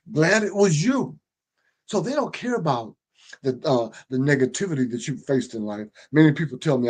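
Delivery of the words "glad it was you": mock gloat. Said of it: "many of them are gonna say".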